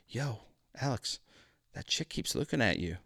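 hushed, low aside to a buddy; soft, discreet murmur; confidential tip-off. Retold or imitated. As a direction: tip off your friend quietly. Roommate quietly tips him off.

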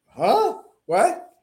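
high-pitched, incredulous double take; baffled disbelief. Retold or imitated. Student retelling his reaction to the counselor's assumption, baffled. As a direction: blurt it as a retold stunned reaction.

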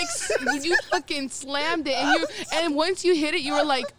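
usual speaking voice

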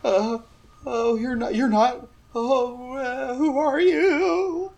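high, timid frightened voice; shaking, tearful man. Acted. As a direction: trail into a slow, high wail. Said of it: "a man crying, shaking".

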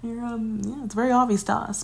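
pat her on the head with your voice; mock reassuring. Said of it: "And I'm like".

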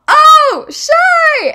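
very high, loud squeal of recognition; imitating a friend's excited holler; retold delighted realization. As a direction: yell it as it suddenly dawns. Friend realizes, shouts right name.

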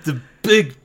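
high, loud, drawn-out mock grandeur; hammed-up grand voice. Friend joins the dance joke, hammed-up high voice.

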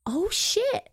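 high, rising mock gasp of realisation; mock shock. Girl learns of her photo in his locker, mock-shocked. Acting Ty's shocked reaction.

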